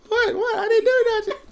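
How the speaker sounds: high, squeaky "what, I didn't do that" denial; mock innocent denial. Boyfriend mocking his girlfriend's innocent denial, high and squeaky. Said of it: "you're like".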